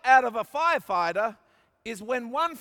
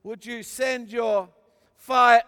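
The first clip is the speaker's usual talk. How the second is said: slow plea with fire shouted louder; loud prayer cry; 6 dB louder, slower than usual. Retold or imitated. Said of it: "And then he said".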